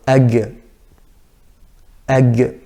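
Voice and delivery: ag with a g sound; g error. Shown as a demonstration. Teacher imitating the g error. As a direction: ag instead of aq. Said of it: "rather than aq".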